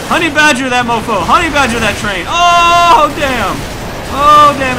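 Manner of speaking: loud, high whooping hype; hyped-up gamer yell; excited shouting. Real, not acted. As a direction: holler wildly at the action as it happens. Excited shouting at game action.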